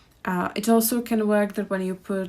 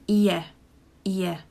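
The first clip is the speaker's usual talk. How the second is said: ordinary talk, nothing marked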